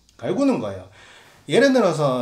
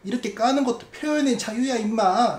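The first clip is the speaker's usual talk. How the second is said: mock heckler; 6 semitones higher than usual. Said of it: "요렇게 말하는데".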